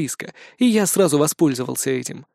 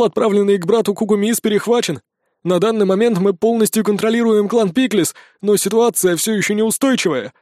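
higher, eager servile elf; fawning servant voice; 6 semitones higher, 3 dB louder than usual. A narrator's reading. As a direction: lay it on thick for your lord.

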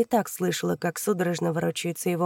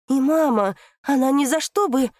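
loud plea; 7 semitones higher, 6 dB louder than usual. Acted. Daughter cries out for mother.

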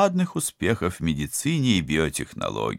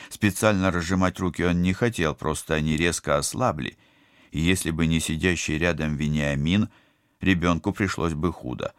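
ordinary delivery

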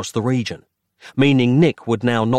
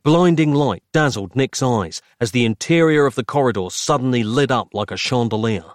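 plain reading voice

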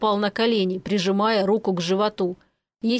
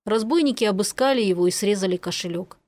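ordinary delivery, nothing marked

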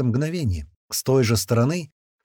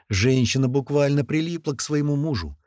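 ordinary delivery, nothing marked

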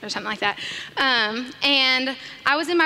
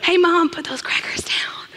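whisper; 4 semitones higher than usual. Retold or imitated. Coaxing mother gently.

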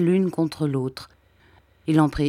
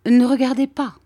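embarrassed plea; 7 semitones higher, 6 dB louder than usual. A narrator's reading. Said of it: "me dit-il en rougissant".

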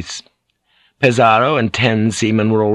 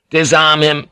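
higher-pitched officer's command; raised voice of command; sharp order; 7 semitones higher, 4 dB louder than usual. A narrator's reading. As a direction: order the men abruptly. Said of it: "Ferriera ordered".

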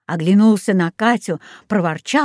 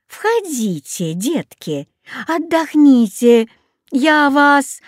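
put-on friendly old woman; 6 semitones higher, slower than usual. A narrator's reading. Old woman calls children in from the doorstep, friendly coaxing. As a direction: stretch the invitation like a storybook granny. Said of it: "старушка и приветливо сказала".

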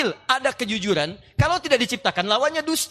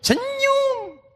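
bright high smile. Shown as a demonstration. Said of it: "Anda tinggal senyum".

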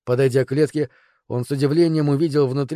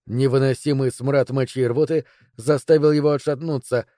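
usual speaking voice

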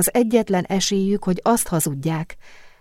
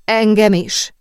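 mild groan, pitched up; groaned, raised-voice answer; groaned reply; 5 semitones higher, 6 dB louder, slower than usual. A narrator's reading. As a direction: groan it out loudly to your friend. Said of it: "nyögte Sophie".

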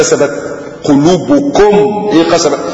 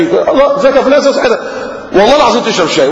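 effusive greeting; 8 semitones higher, faster than usual. Retold or imitated. Greeting a friend on the street.

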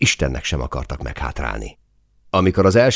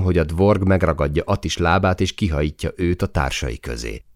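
ordinary talk, nothing marked

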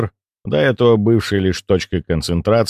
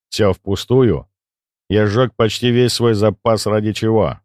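usual speaking voice

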